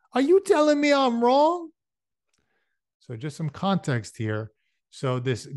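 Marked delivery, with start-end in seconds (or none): 0.1-1.7 much higher, louder challenge; menacing challenge. Acted. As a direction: dare him to say it again. Wilson challenges Ernie.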